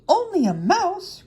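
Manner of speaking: high, loud, drawn-out squeak of offence; indignant cry. A narrator's reading. Little mouse offended at being belittled, indignant. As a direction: cry it out slowly, high and affronted. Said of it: "cried the little animal indig-, indignantly".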